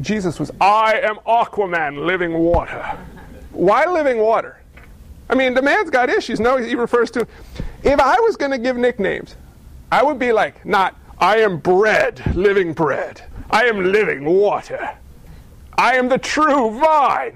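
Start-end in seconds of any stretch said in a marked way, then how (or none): 0.6-2.8 slow, grand superhero announcement; mock superhero. Preacher mocks Jesus as a superhero, slow and grand. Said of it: "It's like Aquaman". 11.2-17.3 slow, grand self-introduction; mock superhero names. Preacher jokes at the nicknames, slow grand hero voice. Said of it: "these are not good superhero names".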